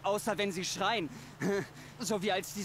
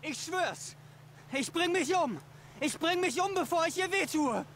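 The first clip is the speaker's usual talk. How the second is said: higher, frantic voice of a tormented man; panicked despair; 8 semitones higher than usual. Acted. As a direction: plead in panic at the edge. Brom panicking at the cliff edge.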